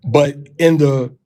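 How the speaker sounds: usual speaking voice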